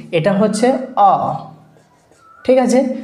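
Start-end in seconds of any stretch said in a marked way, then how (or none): 1.0-1.3 অ drawn out slowly as a pure open "aw"; isolated vowel sound. Shown as a demonstration. Teacher showing learners the sound of letter A, অ held out by itself. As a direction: hold অ alone as a long vowel. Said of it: "যেটাকে আমরা এ বলি".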